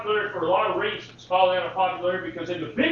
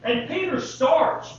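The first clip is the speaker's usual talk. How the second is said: ordinary delivery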